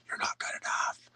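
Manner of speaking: hissed whisper of a nagging saboteur; whispered inner voice. Retold or imitated. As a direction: whisper it like a voice in your head.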